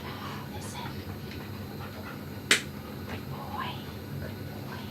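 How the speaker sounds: soft, secretive whisper to a dog; hushing whisper. Real, not acted. Groomer telling the dog secrets so he listens, whispering. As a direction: whisper and shush to settle him.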